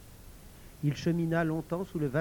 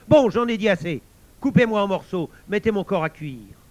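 high, brash order easing at the end; cocky villain barking orders; impatient loud command; 4 semitones higher, 8 dB louder than usual. Acted. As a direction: cut the chant short and boss them. Boaster impatiently orders his cutting.

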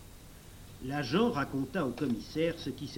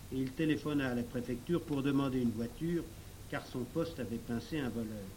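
ordinary delivery

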